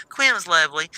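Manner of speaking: ordinary delivery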